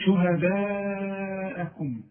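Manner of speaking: on شهداءكم, hold the alif, slow; long madd. Shown as a demonstration. Teacher demonstrates the four-count madd. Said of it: "لازم أمد المد".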